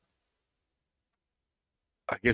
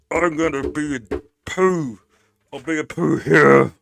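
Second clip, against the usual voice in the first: mocking Pete; 8 semitones higher, 12 dB louder than usual. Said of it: "Pete wants to be like".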